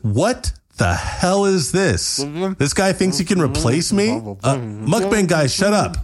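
high, indignant mock rant; mock outrage. Sam outraged at his AI copy, high and indignant. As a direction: act offended and fired up.

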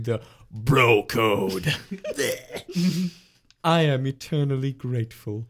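mock heartfelt bro confession; hammed-up [name]. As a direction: deliver it like a grand sincere speech. [name]'s mock speech.

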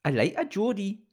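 high-pitched mock Geordie; Geordie accent. Showing off to the co-host, high Geordie accent. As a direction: do a playful Geordie impression. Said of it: "are you doing a good impression of one".

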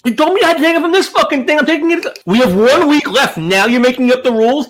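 high, heated complaining; exasperated protest. Real, not acted. Co-host objecting to new drinking rules, exasperated. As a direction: argue back indignantly, pitch climbing.

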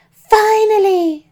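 loud, high, drawn-out call; shouting mum. A narrator's reading. Mum answering her son's arrival, shouting. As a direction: holler it slowly from another room. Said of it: "shouted my mum".

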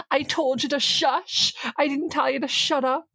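high, mock-exasperated imitation of Orla; high-pitched mock Orla insisting; mimicking Orla. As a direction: pitch up into a put-upon Orla voice. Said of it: "She's like".

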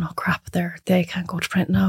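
whispered private panic; panicked whisper. Retold or imitated. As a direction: whisper it so the client can't hear. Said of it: "you're going".

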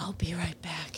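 very soft whisper. Real, not acted. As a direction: whisper it as you slip out. Stepping away, whispered.

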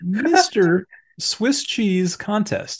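slow, high, grand mock title; mock announcement. To his co-host, slowly announcing the silly title. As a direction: draw out each word like a grand title.

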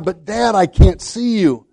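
high-pitched imitation of a boy calling down; mild mock yell, pitched well up; retold boy's yell. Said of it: "the little boy yelled down".

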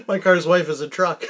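giggling over the slip-up; cracking up at his own mix-up; laughing. Real, not acted. Mixes up words, laughing.

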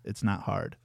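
normal talking voice

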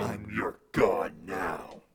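low, slow, menacing; godlike menace. Retold or imitated. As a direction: declare it slowly, ominous. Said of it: "talking about God".

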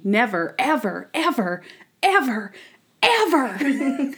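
separate, mounting "ever" repeats, playfully overdone; mock emphatic taunt. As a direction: hammer every "ever" as its own word, rising. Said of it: "he's basically telling Monk".

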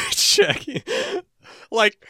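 squeaky giggly Shaggy, like, zoinks; Shaggy impression. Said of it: "Like, Zoink, Scoob".

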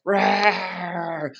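long playful roar; held mock roar; bear roar. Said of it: "Back to a bear".

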